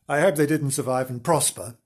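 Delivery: ordinary delivery, nothing marked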